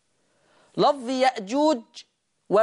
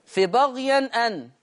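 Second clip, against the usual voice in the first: ordinary talk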